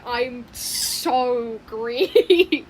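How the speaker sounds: drawn-out, stretched I'm so green; slow character line. Retold or imitated. Imitating the green one for the viewers, slow and drawn out. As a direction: mimic the green character slowly, stretching each word. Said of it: "it's Greenity".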